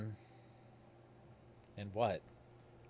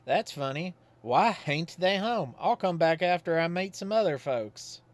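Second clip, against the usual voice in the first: put-on folksy old Godfrey; 7 semitones higher, 6 dB louder than usual. Godfrey calls at Susie's house, folksy and higher. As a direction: play him as a simple, cheerful Southern townsman.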